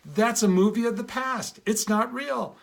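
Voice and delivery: light up as it clicks; excited realisation. Retold or imitated. Girl realising her anger is a movie, excited.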